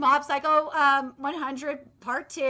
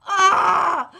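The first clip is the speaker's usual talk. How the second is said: a short loud groan of frustration; frustrated yell; 3 semitones higher, 9 dB louder than usual. Real, not acted. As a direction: let out a sharp frustrated "Ah". Groans over disappointing adaptation.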